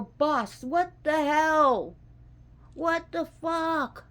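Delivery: loud, high, slow outraged shout; exasperated yell. Retold or imitated. Devotee yelling at the divine boss, loud and outraged. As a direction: drag out what the hell at full volume. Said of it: "you ever yell at your deities".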